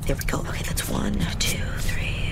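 counting under her breath; hushed whispered counting; whispering. Acted. Quietly counting stolen money.

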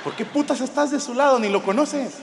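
indignant, arguing your side; heated complaint. Retold or imitated. Complaining to his wife about taking Manolo's side, heated rant.

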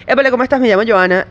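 quick Spanish with the final S's dropped; lively, casual Spanish, S's at word ends left off; natural Caribbean-style Spanish. Shown as a demonstration. Host showing how they used to say it, natural Spanish accent. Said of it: "to pronounce S's at the end of words".

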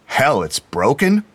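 loud, high, slow complaint; grousing. A narrator's reading. McBride when the tape player does nothing, grousing. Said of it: "McBride groused".

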